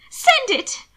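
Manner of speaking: high, loud, urgent command; insistent. A narrator's reading. Sister urges her brother to post the letter, loudly insistent. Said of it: "Mina insisted".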